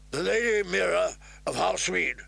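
high-pitched herald's call; bellowed announcement. A narrator's reading. Guardsman announcing guests over the clamor, bellowed. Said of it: "the rotund guardsman bellowed over the clamor".

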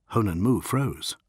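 ordinary delivery

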